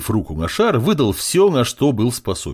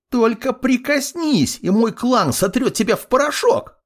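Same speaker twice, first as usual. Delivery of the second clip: squealed threat; 14 semitones higher than usual. A narrator's reading. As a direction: squeal the threat like an outraged brat. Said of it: "завизжал тот".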